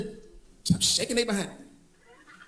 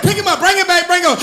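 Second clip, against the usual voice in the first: higher commanding preacher; 6 semitones higher, 4 dB louder than usual. Retold or imitated. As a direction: order the ushers like a preacher. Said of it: "He said, he said".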